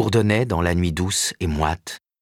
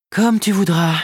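higher, resigned agent; Karen giving in; 13 semitones higher, 5 dB louder than usual. Acted. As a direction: give in with a shrug. Karen gives in.

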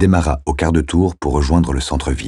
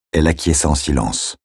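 ordinary delivery

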